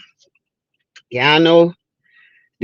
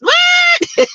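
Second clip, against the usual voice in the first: surprised yelp. Real, not acted. Startled by a test mistake.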